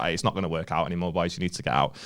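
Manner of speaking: low, soft, retold dismissal; discreet firm warning. Colleague tells the lads to leave, quiet and firm. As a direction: keep it down as you send them away.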